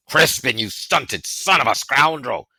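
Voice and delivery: put-on loud, scolding bellow at the boy; angry shout. A narrator's reading. Bear calls out to lost Crispin, angry shout. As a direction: Bear roars at Crispin in anger. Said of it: "even angrier".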